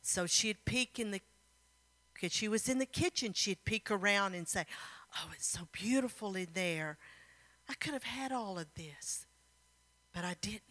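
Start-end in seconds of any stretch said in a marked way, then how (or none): 5.2-10.6 soft, half-whispered longing; hushed, wistful aside; wistful murmur. Acted. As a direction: murmur it softly to yourself with regret. Peeks in, wishing it were hers.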